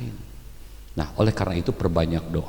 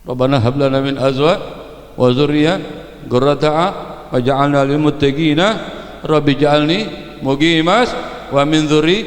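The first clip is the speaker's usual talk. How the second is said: prayer recitation; 6 semitones higher than usual. Acted. Preacher reciting a prayer for children, steady chant.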